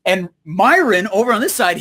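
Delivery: ordinary talk, nothing marked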